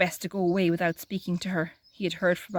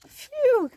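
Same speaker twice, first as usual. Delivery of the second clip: high loud sigh of relief; big relieved phew; 5 dB louder than usual. Acted. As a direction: let the relief burst out. Relief at Peter's escape.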